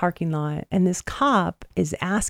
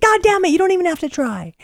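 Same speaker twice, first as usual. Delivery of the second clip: high-pitched exasperated retelling; playfully fed up; mock exasperation; 11 semitones higher than usual. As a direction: protest in a higher voice at how easy it is. Retold exasperation at effortless winner.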